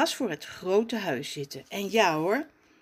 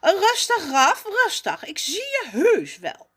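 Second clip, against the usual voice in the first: higher, louder curt hushing; curt reply; 12 semitones higher, 7 dB louder than usual. Acted. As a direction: brush off the noisy cousin. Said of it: "antwoordt kortaf".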